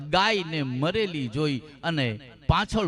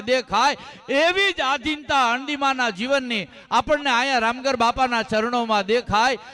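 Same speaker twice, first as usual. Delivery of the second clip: full-force preaching; 7 semitones higher than usual. Acted. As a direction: preach it out, building. (ઊંચા, જોરદાર પ્રવચન સ્વરે)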